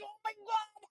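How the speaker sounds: high, soft squeal of an overwhelmed fan; dying. Retold or imitated. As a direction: re-enact swooning in a tiny high voice. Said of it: "I was, like, dying".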